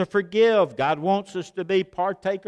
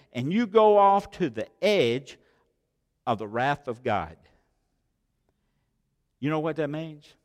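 normal talking voice

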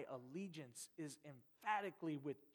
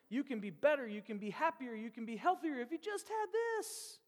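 lightly hammed-up salesperson, climbing higher; mock advert pitch; 6 semitones higher, 4 dB louder than usual. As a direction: parody of an eager TV ad. Acts out a TV advertisement.

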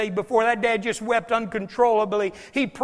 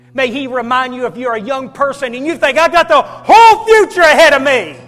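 loud, high, building to a shout; fervent shouted exhortation; full-force preaching; 5 semitones higher, 14 dB louder than usual. Real, not acted. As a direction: preach it hard, crying out over the congregation.

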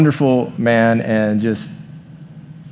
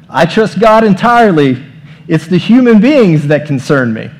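raised, louder quoted punchline; emphatic quote; 6 semitones higher, 7 dB louder than usual. Retold or imitated. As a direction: punch the joke line up loud. Quoting Wimber, punchline raised.

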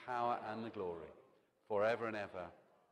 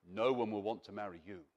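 sinister voice; 8 semitones lower, 4 dB quieter than usual. Pretend. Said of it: "Voice from another world".

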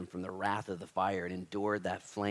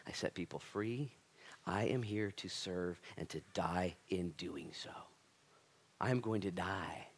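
soft, slow, deliberate declaration; hushed, measured firmness; quiet resolve; 6 dB quieter than usual. Acted. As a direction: state it gently but with determination. Voicing Jesus' resolute answer.

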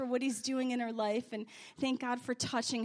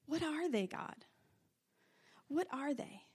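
quiet prayer; 2 semitones higher, 7 dB quieter than usual. Shown as a demonstration.

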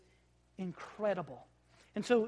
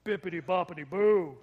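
mock solemn Bippity boppity boo, pushed a touch deeper; hammed-up magic words, trying to sound weightier; 3 semitones lower, 7 dB louder than usual. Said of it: "Maybe we need to say it deeper".

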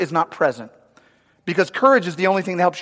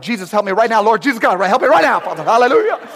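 panicked prayer; 3 semitones higher, faster than usual. Retold or imitated. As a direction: beg for help mid-fall. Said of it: "I was like, I prayed the whole way down".

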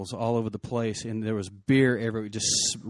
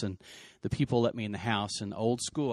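usual speaking voice

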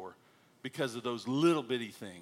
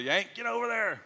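heckling driver; 8 semitones higher than usual. Retold or imitated. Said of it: "I get a lot of honks and a lot of".

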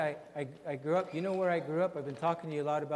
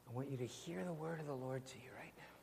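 whispered the second time, unvoiced and hushed; soft prophetic whisper over the room; 12 dB quieter, faster than usual. Real, not acted. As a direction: lean in and whisper the promise. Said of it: "repeated phrase whispered".

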